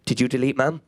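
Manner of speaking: low, menacing phone interrogation; Liam Neeson. Retold or imitated. Sister phones her brother from London, deadly serious Liam Neeson. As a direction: drop your pitch into a grave action-hero question. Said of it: "Who is this, Liam Neeson?".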